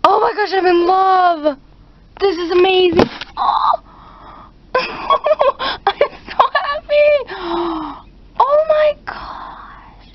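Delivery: very high, slow, teary squeal; gush, voice breaking with joy; happy tears. Real, not acted. Overjoyed vlogger near happy tears over her new pet. Overwhelmed, says she'll cry.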